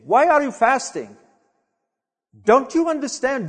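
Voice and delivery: ordinary delivery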